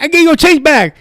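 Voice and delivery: high, loud mock protest; shop kid. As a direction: insist you already paid him. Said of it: "he's like".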